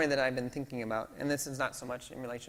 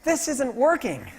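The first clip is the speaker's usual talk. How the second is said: realization; 11 semitones higher, 12 dB louder than usual. Retold or imitated. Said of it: "I think".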